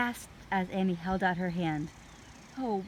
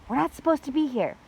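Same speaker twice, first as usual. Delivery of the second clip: urgent hush; 7 semitones higher, 8 dB louder, faster than usual. A narrator's reading. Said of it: "said Jack".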